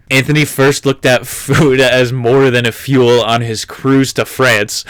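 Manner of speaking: ordinary talk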